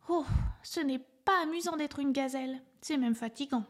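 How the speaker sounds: a small elephant sighing at failure; little elephant voice. A narrator's reading. Little elephant after tiring hop, mildly disappointed high voice.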